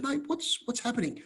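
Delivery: high, hushed confused asking; retold baffled plea, pitched high and soft; bewildered question. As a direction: ask the driver as if nothing makes sense. Asking the bus driver, baffled.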